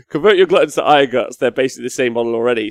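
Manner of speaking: normal talking voice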